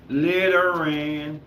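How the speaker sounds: Littering said very slowly, each syllable stretched; drawn out. Shown as a demonstration. Repeats the topic word slowly.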